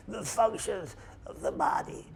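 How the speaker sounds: breathless whisper of a pneumonia patient; barely voiced, as if too ill to speak; weak sick whisper. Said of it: "He's talking like".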